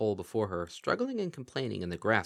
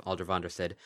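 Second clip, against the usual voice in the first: ordinary delivery, nothing marked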